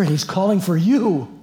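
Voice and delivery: high, loud and drawn out; excited summons. Acted. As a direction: call it out to your sister, stretching the last words. Acting out Martha calling her sister.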